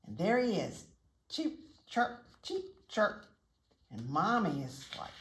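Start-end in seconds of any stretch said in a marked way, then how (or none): 1.3-3.3 short high chirps; chirping chick. A narrator's reading. Happy chick calls out to mommy, high chirping. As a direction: peep like a little chick. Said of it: "he chirped and he crowed".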